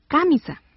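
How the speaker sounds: "camisa" wrongly stressed on the first syllable, CÁ-misa; "camisa" said as cámisa. Shown as a demonstration. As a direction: hit the "ca" of "camisa". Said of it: "si estuviera en K".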